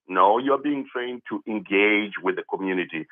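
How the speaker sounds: ordinary talk, nothing marked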